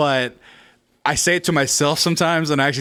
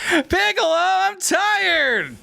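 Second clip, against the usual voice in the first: high whiny complaint; whiny Gohan voice; much slower than usual. Retold or imitated. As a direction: whine like a worn-out kid. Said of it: "my whiny voice".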